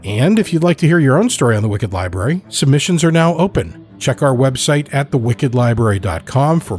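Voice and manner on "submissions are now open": ordinary delivery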